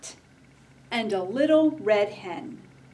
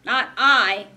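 louder duck quack voice; 2 semitones higher, 9 dB louder than usual. Acted. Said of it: "quacked the duck".

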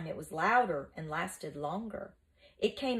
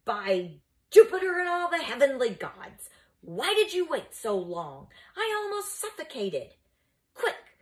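high, loud outraged outburst; ferocious growl; 8 semitones higher, 5 dB louder than usual. Acted. Teacher just ungagged, berating his pupils ferociously. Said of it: "growled ferociously".

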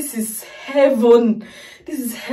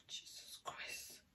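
breathless awed whisper; hushed awe; 37 dB quieter, slower than usual. Real, not acted. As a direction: whisper it, overwhelmed. Overwhelmed reacting to song.

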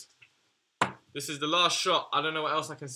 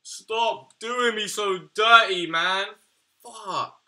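much higher, louder, slow anguished wail; anguished yell; 8 semitones higher, 10 dB louder, slower than usual. Real, not acted. Yelling at the game after another bad unlock, anguished wail. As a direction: howl it out in despair.

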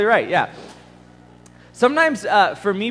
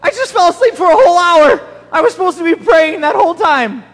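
much louder, higher startled panic; panicked waking; 12 semitones higher, 10 dB louder than usual. Retold or imitated. Boy waking after oversleeping his prayer time, loud panic. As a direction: jolt awake in alarm. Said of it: "wake back up and I'd be like".

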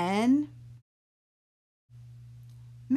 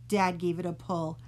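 plain reading voice

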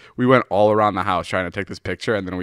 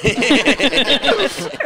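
high, loud burst of laughter; 4 dB louder than usual. Real, not acted. Laughing at the coworker's reaction, high and loud. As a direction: crack up at the memory.

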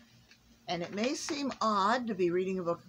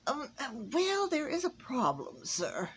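mumbling elf; 7 semitones higher than usual. A narrator's reading. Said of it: "mumbled Sir Cedric".

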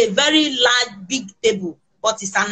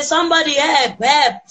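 raised, higher mock shout for help; a retold plea yelled a bit louder; retold call for help; 6 semitones higher, 4 dB louder than usual. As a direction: cry out for help, half-performed. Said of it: "from shouting that help".

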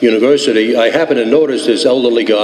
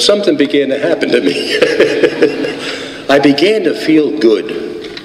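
laughing through it, slowing down as he says he felt good; 3 semitones higher than usual. Real, not acted. Laughing recalling first drink.

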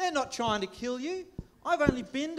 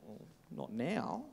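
dry low mutter; 10 semitones lower, 12 dB quieter, slower than usual. Acted. Dry reply to toilet joke.

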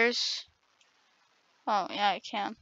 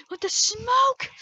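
hyped taunt; 12 semitones higher than usual. Real, not acted.

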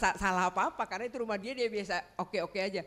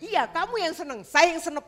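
higher, louder teasing comeback; sarcastic retort; 7 semitones higher, 7 dB louder than usual. Retold or imitated. Wife retorts to husband with sarcastic wordplay, louder. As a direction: snap back with a wry pun.

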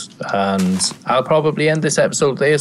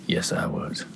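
smug whisper; 10 dB quieter than usual. Acted. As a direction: hiss it back smugly. Smug reply in squabble.

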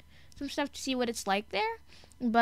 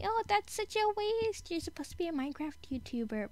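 high-pitched mock complaint from a viewer; imagined disapproving viewer; 9 semitones higher than usual. Viewer objecting to a Minecraft YouTuber's choices, mock complaint. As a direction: mimic a let-down viewer, pitched well up. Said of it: "You're probably thinking".

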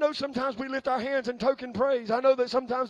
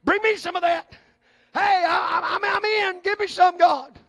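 loud, high, laughing plea; eager call; 6 semitones higher, 8 dB louder, slower than usual. Retold or imitated. Preacher acting out begging God for a blessing. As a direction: beg cheerfully to be included. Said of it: "I lift my hand and say".

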